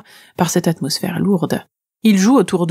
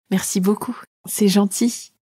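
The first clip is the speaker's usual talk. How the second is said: a slightly higher, softer, sweet little voice; 3 semitones higher, 3 dB quieter than usual. Pretend. Girl thanks the dragon to charm him, little voice. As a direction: play small and cute to charm him. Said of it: "en prenant une petite voix".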